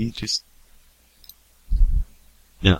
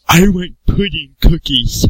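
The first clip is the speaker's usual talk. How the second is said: high, slow, goofy put-on voice; Jordan Taylor voice; 10 semitones higher, slower than usual. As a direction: drag it out in a silly childish voice. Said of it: "Where he's talking like".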